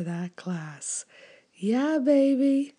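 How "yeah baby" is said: higher, louder, slow cheer; excited. Real, not acted. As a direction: cheer it out with gusto. Cheering herself up.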